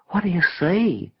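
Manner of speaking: high-pitched, urgent query; puzzled question. A narrator's reading. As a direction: ask it high, wanting a clue. Bewildered friend asks the visionary.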